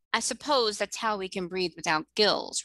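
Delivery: plain reading voice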